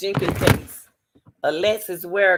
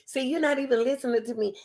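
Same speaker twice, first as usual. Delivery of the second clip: mock complaining man; 6 semitones higher, faster than usual. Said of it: "the man complains".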